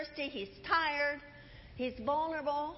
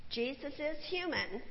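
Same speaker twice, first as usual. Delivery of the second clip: ordinary delivery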